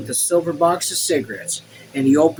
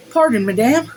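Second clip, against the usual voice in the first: higher, louder servant voice; servant Joseph; 9 semitones higher, 5 dB louder than usual. A narrator's reading. Joseph interrupting Madame, higher servant voice. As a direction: lift it into an apologetic servant's voice.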